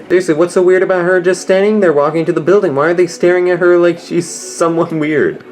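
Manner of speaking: indignant outburst. Real, not acted. Viewer protesting the staring crowd, loud and indignant. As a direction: demand an answer, fed up.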